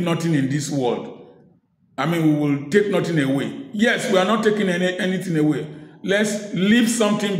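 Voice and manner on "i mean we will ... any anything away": ordinary delivery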